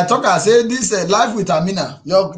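ordinary talk

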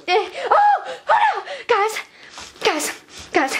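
high-pitched cry of dismay; mock panic. Vlogger to viewers after dropping sunglasses, panicked. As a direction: wail it as if disaster just struck.

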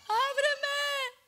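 high, drawn-out plea at a door; calling out. Acted. Preacher voicing the Lord knocking to be let in, calling out. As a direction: call through the door, pitched well up. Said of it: "el Señor está llamando".

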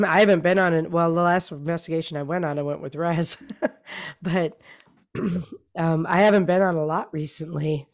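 losing her voice; hoarse, fading voice with throat clearing. Real, not acted. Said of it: "I'm losing my voice again".

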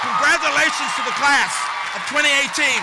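loud, high, ringing orator's charge; rousing commencement exhortation. Real, not acted. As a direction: build it like a preacher, stretching the key words. Rousing close of commencement speech.

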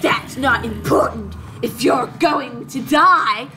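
slow, forced sinister voice; strained throaty villain menace; creepy villain voice. Acted. Assassin threatening the hero with death, creepy voice. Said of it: "I'm using my creepy voice for this".

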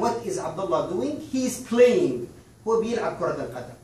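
ordinary talk

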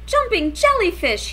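high, loud startled cry. A narrator's reading. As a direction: cry out after the crash. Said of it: "he cried".

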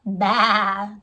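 low baa; sheep bleat. Shown as a demonstration. Teacher shows what a sheep says, bleating. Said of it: "What sound does a sheep make".

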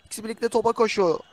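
high-pitched running match commentary; excited play-by-play. Real, not acted. Commentator calling an attack for viewers, excited. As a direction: keep the attack urgent and high.

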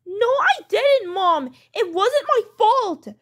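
high, drawn-out denial; protesting child. Acted. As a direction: whine that you are blameless. Child denies blame.

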